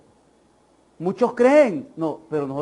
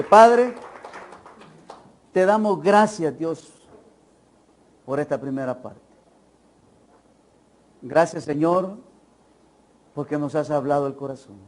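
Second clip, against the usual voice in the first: earnest prayer. Acted. Preacher praying aloud for the congregation, heartfelt. Leads a prayer over music.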